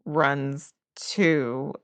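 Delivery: usual speaking voice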